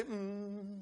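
sustained worshipful "mm"; moan in the spirit. Shown as a demonstration. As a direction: hum it softly for them to copy. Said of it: "Can you just say".

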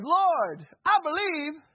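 high, loud plea to the Lord; crying out. Acted. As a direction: call out desperately, voice pitched far up. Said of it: "cried out and said".

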